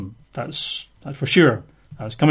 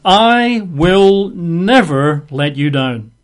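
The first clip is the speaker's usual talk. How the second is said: slow, louder, every word weighted; emphatic; 3 semitones higher, 4 dB louder, much slower than usual. Shown as a demonstration. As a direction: press hard on "never" in "I will never let you down". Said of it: "You can't get any more emphatic".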